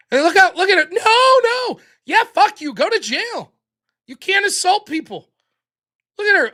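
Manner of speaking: loud gloating. Real, not acted. Streamer jeering loudly at the arrested woman on video. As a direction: crow over her getting caught.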